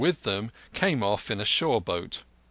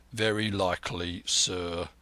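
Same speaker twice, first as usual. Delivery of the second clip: lower, dry and quiet; a hint of a stern captain; 5 semitones lower, 4 dB quieter than usual. A narrator's reading. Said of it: "replied Captain Smollett".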